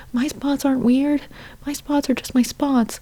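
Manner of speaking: high-pitched cow standing up for herself; Bertha. Acted. Teased cow defending her spots, high put-on voice. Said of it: "Bertha's over there being like".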